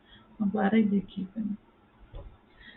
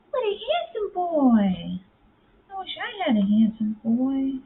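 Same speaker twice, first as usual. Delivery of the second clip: much higher, sing-song cooing at the cat; 10 semitones higher, slower than usual. Real, not acted. Owner gushing at her handsome cat, high sing-song coo.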